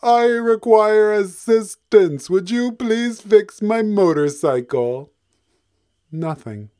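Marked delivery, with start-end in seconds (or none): 0.0-5.1 loud, slow, high call for help; yelled out. Acted. Hippo yelling for help into an empty repair shop, loud and slow. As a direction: project it across an empty shop. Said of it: "He yelled out".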